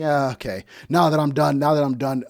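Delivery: hammed-up wife, gloating; mocking wife. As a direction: imitate her smug win. Mocking the wife's voice.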